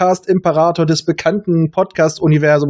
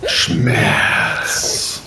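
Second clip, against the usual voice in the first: lower, whispered ominous single word; 13 semitones lower than usual. Acted. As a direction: breathe the word like a dark oracle. Acted Dune test scene.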